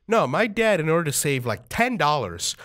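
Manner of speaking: ordinary talk, nothing marked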